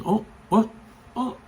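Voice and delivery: high-pitched, acted little start of surprise; startled realization. Mother alerted by the code word, imitated surprise. Mother suddenly notices her kids' mess.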